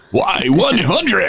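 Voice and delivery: high, slow, drawn-out DJ shout of the call letters; radio jock call letters. Retold or imitated. DJ retelling his on-air call, high drawn-out jock voice. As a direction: belt the station name like a rock jock. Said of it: "I went and I puked it up".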